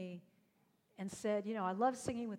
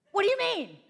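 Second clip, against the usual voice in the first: much higher, louder astonished question; baffled stranger; 11 semitones higher, 13 dB louder than usual. Retold or imitated. Stranger at Starbucks, startled by the offer, high and loud. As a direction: play the woman's shocked disbelief.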